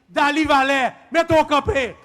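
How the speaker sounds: loud, rousing introduction; calling for applause. Real, not acted. Speaker saluting the party's papa before the crowd, rousing call. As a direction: raise your voice to get the crowd up.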